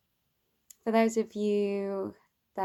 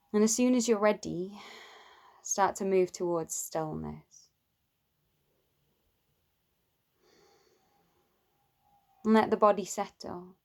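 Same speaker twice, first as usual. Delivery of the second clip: guided meditation. Acted. Teacher leads listeners towards love for oneself, calm guided meditation. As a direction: guide them gently inward, leaving space between phrases.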